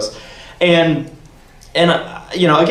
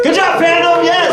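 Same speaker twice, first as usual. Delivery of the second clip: high shouted congratulation; high happy shout to the crowd; excited cheer; 14 semitones higher than usual. Real, not acted. Cheering the fandom on.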